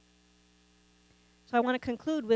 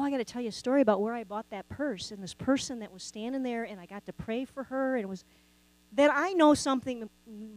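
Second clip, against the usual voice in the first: ordinary talk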